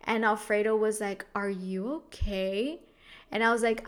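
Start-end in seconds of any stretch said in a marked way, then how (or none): 1.3-2.8 slow, questioning check-in with rising swoops; concerned partner. Retold or imitated. Alfredo checks on crying partner in bed, slow imitated concern.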